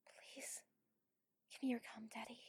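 ordinary talk

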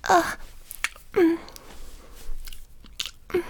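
rising breathy moans; moan, building to a cry. Acted. Intimate roleplay, moaning.

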